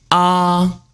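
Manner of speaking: AH held high and level; high first tone. Shown as a demonstration. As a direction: hold "ah" on one high, even note. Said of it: "First tone high".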